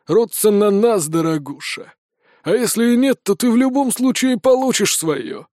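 put-on gruff male voice; booming bandit. A narrator's reading. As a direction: voice a threatening brigand. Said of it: "пробасил мужской голос".

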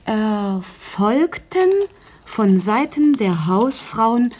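measured, word-by-word dictating pace; slow dictation. Acted. Dictating the meeting report to the writer, slowly. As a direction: spell out each phrase for the scribe.

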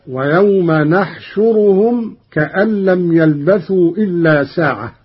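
نحشرهم begins with nun, not ya; Qalun reading. Shown as a demonstration. Teacher demonstrating نحشرهم with nun, recited. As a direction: say نحشرهم with an n, nahshuruhum. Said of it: "قرأ قالون بنون العظمة هكذا".

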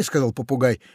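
plain reading voice

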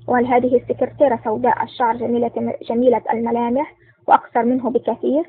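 ordinary delivery

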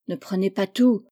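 ordinary delivery, nothing marked

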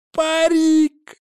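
high, loud, slow jeering cry; gleeful shout. A narrator's reading. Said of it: "воскликнул он".

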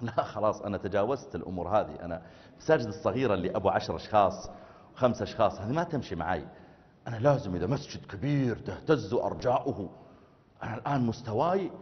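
a mild haughty self-talk; arrogant inner voice. Pretend. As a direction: sniff at small mosques. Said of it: "فتقول في نفسك".

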